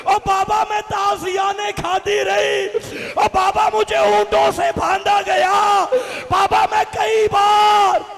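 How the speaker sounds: high, loud keening of the daughter's pleas; wailing cry. Acted. Preacher voicing Sakina telling her father her suffering, loud wailing lament. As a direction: cry out each ओ Baba as a sung wail.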